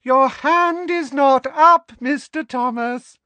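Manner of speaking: very high, loud, slow sing-song; trilling. A narrator's reading. Professor cutting off a student without his hand up, trilling. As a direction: trill it like a piercing rebuke. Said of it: "trilled Professor Umbridge".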